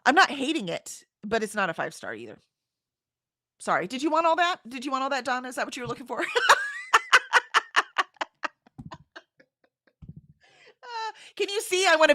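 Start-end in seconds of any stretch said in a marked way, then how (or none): 3.9-4.6 bright teasing question. Real, not acted. Teasing check on the chatter. 6.3-8.5 high laugh. Real, not acted. Laughing at her own rambling.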